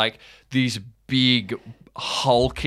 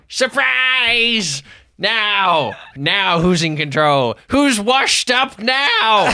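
high, loud, crowing villain; cackling hostage-taker, higher and louder, slow at the end; gloating ambush; 11 semitones higher, 4 dB louder, slower than usual. Acted. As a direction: seize the king and crow about it. Norbert ambushes the king, laughing.

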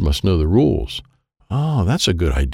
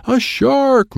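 high-pitched storybook scream. A narrator's reading. Girl spots a shark in the snow, screamed alarm. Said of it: "Monica screamed".

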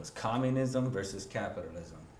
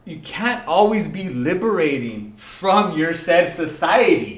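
loud, fired-up argument; passionate; 7 semitones higher, 10 dB louder than usual. Real, not acted. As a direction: hammer the point home, loud and high. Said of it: "getting so passionate in here".

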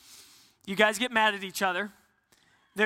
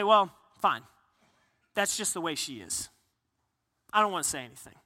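resigned; 4 semitones lower than usual. Acted. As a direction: give up on the argument, trailing down. Husband giving up in argument.

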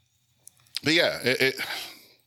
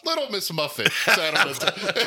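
higher, laughing mock comic voice; Andrew Dice Clay bit; 10 semitones higher than usual. Imagining Andrew Dice Clay on stage, laughing impression. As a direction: ham up a crude nursery rhyme. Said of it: "Could you imagine Andrew Dice Clay coming out?".

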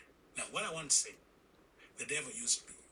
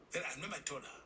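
ordinary talk, nothing marked

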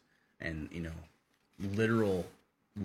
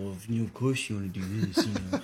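deceitful voice; 3 semitones higher, 6 dB louder, faster than usual. Retold or imitated. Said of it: "but I guess deceitful".

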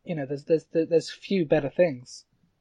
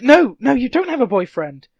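offended woman; 7 semitones higher, 6 dB louder than usual. Retold or imitated. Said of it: "where it's like".

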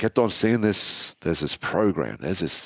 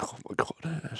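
higher, much softer relieved gasp of words; relieved breath; 7 semitones higher, 12 dB quieter, slower than usual. Real, not acted. Husband hearing his wife will help, breathy relief.

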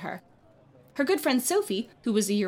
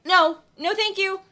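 flustered polite refusal; 10 semitones higher, 5 dB louder, slower than usual. A narrator's reading. Brushing off a hawker.

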